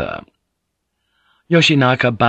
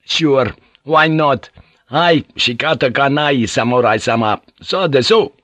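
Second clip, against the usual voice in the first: raised, falsely agreeable voice; forced smile; 5 semitones higher, 3 dB louder than usual. A narrator's reading. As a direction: give in with exaggerated, insincere courtesy. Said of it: "forced a smile back on his face".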